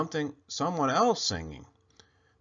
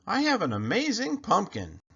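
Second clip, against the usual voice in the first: put-on higher, excited boyish boast; proud boy; 8 semitones higher than usual. Clayton boasts to himself about his pumpkin, high and proud. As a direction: crow about it like a proud kid.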